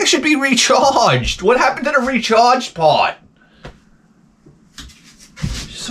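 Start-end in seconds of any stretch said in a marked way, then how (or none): 0.0-3.1 high, slow, baffled whine; baffled complaint. Retold or imitated. Groggy after a nap, complaining in a high baffled whine. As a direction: whine it like you were promised more. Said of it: "I'm like".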